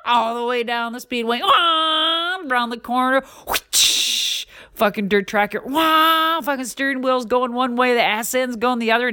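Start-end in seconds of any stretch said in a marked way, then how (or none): 1.4-2.4 excited whoop. Retold or imitated. Racing story sound effects. 3.5-4.5 breathy whoosh with no voice. Retold or imitated. Truck flying sound effect. 5.7-6.4 excited whoop. Retold or imitated. Racing story sound effects.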